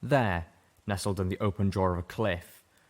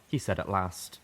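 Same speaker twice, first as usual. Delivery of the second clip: usual speaking voice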